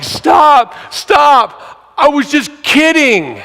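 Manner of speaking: high, loud cry of stop; acted high-pitched holler to call someone off. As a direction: shout it out, then stretch the confession. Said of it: "he's laughing at me".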